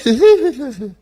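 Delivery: high, loud giddy cackle; mock manic giggle. Host mocking the witness's podcast outburst, manic giggle. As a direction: giggle like an over-excited schemer.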